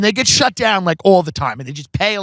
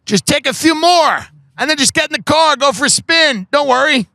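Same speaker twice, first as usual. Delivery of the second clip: high-pitched, egging-on imitation; playful mock goading; 8 semitones higher than usual. Friend goading his parents to drink, playful mock goading. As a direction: talk them into it with mock innocence. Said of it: "He's like".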